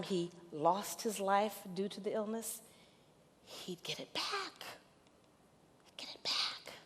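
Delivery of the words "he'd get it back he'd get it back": awed hush. Real, not acted. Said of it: "awed repeat".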